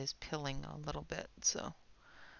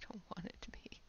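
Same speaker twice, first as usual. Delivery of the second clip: whispering; 2 semitones higher, 9 dB quieter, faster than usual. Real, not acted. Muttered aside while turning page.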